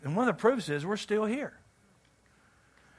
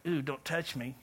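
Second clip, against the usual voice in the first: prissy "Ooh, don't touch me", much softer; mock squeamish; 5 semitones lower, 11 dB quieter than usual. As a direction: recoil in mock disgust.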